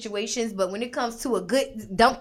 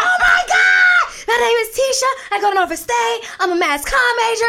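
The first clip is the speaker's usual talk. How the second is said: very high, loud fan gushing; starstruck gushing; 9 dB louder than usual. Retold or imitated. Introducing herself to Andre, high excited gushing. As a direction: gush at the star in one breath.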